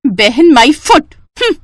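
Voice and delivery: slow, loud, dragged-out sneer; scornful scoff. Acted. Jealous younger sister sneering at her sister's mention. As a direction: spit it out with contempt.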